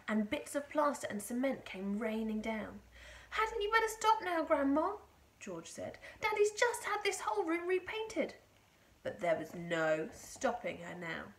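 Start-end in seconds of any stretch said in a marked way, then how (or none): 3.3-5.0 high, raised voice of an anxious grandson; worried boy. A narrator's reading. Boy pleads with growing grandma. 6.2-8.3 put-on high, loud young grandson's complaint; protesting boy. A narrator's reading. Boy protests about damaged room.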